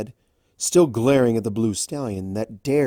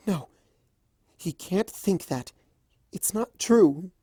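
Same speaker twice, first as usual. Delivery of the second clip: timid voice; 8 semitones higher than usual. Acted. Fluttershy anxious.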